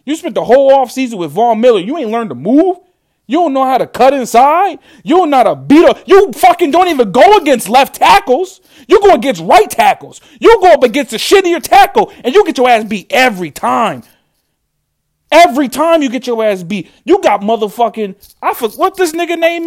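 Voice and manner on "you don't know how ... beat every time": raised, loud, heated tirade at the player; furious fan yelling at a disappointing lineman; angry rant. Real, not acted. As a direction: tear into him, voice up and loud. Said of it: "That's how mad I am".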